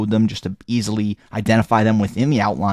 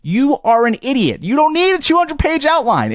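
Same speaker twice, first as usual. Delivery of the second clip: pitched way up in exasperated disbelief; exasperated outburst; 12 semitones higher than usual. Real, not acted. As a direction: scold them sharply, much higher. Exasperated outburst at over-long outlines.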